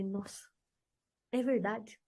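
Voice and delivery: quietly admitting he was right; humbled admission. Retold or imitated. Conceding to her boyfriend about the pijama, softly humbled. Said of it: "E eu falei".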